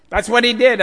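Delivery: loud, high insistence; emphatic. Real, not acted. Preacher insisting to the congregation, loud and emphatic. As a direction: hammer the point home.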